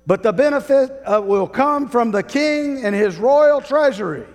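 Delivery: loud, high royal proclamation; town crier. Acted. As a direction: bellow the decree to the square. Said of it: "And then he would say".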